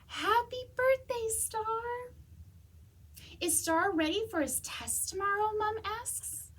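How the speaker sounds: lively, expressive storybook reading; animated picture-book read-aloud; reading to children. Acted. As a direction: read it like story time for kids.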